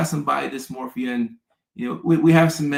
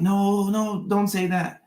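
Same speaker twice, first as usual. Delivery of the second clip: higher-pitched imitated objection; mock protest; 8 semitones higher, slower than usual. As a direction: lightly mimic people correcting you. People protesting at polite address.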